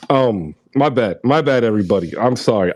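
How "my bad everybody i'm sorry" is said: ordinary talk, nothing marked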